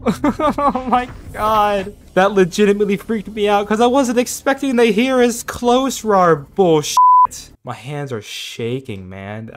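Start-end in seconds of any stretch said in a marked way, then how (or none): 0.1-7.0 high, loud, breathless rush after a scare; shaken and rattled. Real, not acted. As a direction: still reeling from the fright. Still shaken after Reaper scare.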